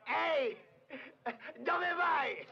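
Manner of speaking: high shout after him; calling out. Acted. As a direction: call him back sharply. Brigadiere chiama chi se ne va. (grida per richiamarlo)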